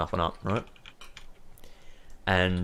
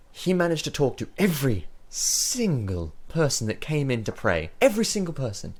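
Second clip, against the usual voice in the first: acted complaint, climbing higher as it goes; exasperated cleric; 7 semitones higher than usual. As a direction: stress each word in weary disbelief. Cleric complains about talkative dwarf.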